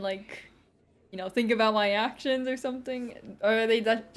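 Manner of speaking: usual speaking voice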